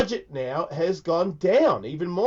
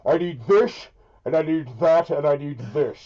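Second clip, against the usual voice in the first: Darth Vader voice. Pretend. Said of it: "like, a Darth Vader thing?".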